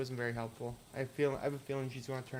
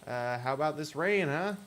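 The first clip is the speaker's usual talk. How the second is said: ordinary talk, nothing marked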